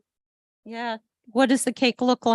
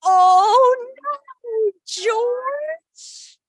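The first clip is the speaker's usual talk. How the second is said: high, loud dismayed cry; 12 semitones higher, 8 dB louder, much slower than usual. A narrator's reading. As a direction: wail at the naughty dog. Scolding the dog again.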